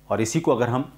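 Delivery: normal talking voice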